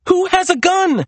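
very high, bewildered cry; alarmed question. A narrator's reading. Serena stumbling behind Maria, alarmed question. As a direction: demand an answer in alarm. Bewildered sister answers screamed warning.

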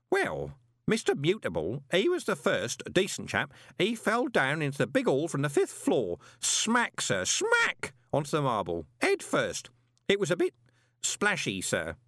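put-on high, chatty old clerk; fussy old postal clerk; old man voice. Groat's voice in dialogue.

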